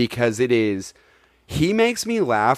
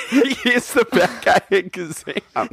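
high, slow, laughing through it; 12 semitones higher than usual. Real, not acted. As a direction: giggle out each word. Cracking up at Kazam joke.

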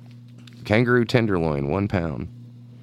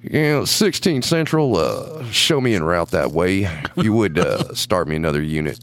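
police radio voice; 6 semitones lower than usual. Retold or imitated. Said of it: "he had a radio voice".

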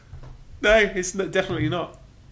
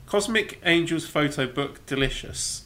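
ordinary talk, nothing marked

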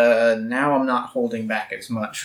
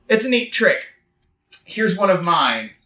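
cocky, grinning quip; smug taunt; 6 semitones higher than usual. Acted. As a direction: smile and taunt him. Hero taunts villain with smile.

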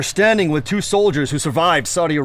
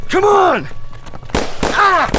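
high loud effortful yell; straining shout; 4 dB louder, much slower than usual. Acted. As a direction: strain and shout under fire. Dragging wounded man under fire.

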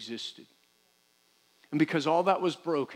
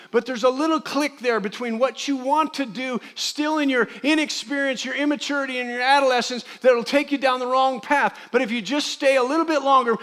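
loud, high preaching run; preaching at full force; 8 semitones higher, 6 dB louder than usual. Acted. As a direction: press the point to the congregation.

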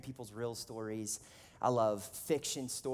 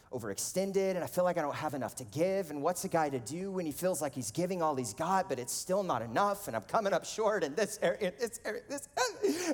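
higher, rising overwhelmed ramble; mock meltdown; 5 semitones higher, 3 dB louder than usual. Retelling his answer to "how you doing", mock meltdown. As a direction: build to a hammed-up, squeaky near-sob. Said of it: "I'm, like, sobbing uncontrollably".

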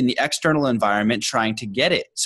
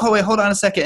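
high, louder mock reaction; acted urgent concern; 10 semitones higher, 5 dB louder, much faster than usual. Hardcore guy responds to an injured stranger, high urgent concern. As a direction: play someone jumping in to help, pitch raised.

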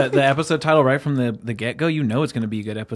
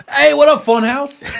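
high excited greeting; 13 semitones higher, 6 dB louder, slower than usual. Pretend. Greeting with new nickname.

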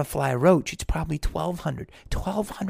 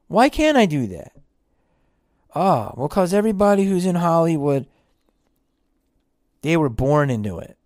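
louder, challenging the dream; defiant doubter; 3 semitones higher, 7 dB louder than usual. Acted. A skeptic arguing that Hollywood is only for insiders, loud. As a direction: push back hard and loud.

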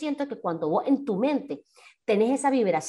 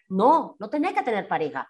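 ordinary talk, nothing marked

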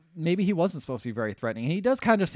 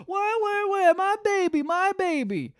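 very high, loud wailing imitation; mock crying; 4 dB louder, slower than usual. Showing her how to fake crying, exaggerated wail. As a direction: wail like a distraught parent, hammed-up. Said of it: "one person was upset back in town".

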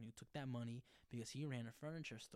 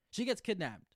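loud, higher repeat of the twist; excited repeat; 9 semitones higher, 12 dB louder than usual. Real, not acted. Host repeating the twist to listeners, loud and excited. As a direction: hammer the shock home again.

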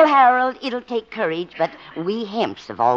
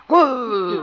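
mock growl back; 7 semitones higher, 3 dB louder than usual. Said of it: "He just growled right back".

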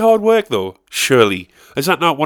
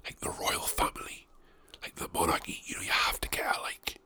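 hushed unvoiced whisper; 15 dB quieter than usual. Real, not acted. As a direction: whisper it like a secret. Whispering at the start.

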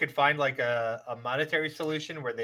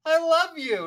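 gushing fan; 12 semitones higher, 4 dB louder, slower than usual. Retold or imitated. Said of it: "and I'm like".

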